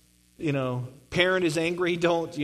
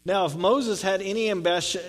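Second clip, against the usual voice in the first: ordinary talk, nothing marked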